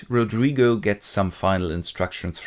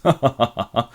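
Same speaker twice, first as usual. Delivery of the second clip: acted chuckle; mock laugh in a row of ha-has. Cassio laughs when asked about Bianca, acted laugh. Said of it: "he just laughs and goes".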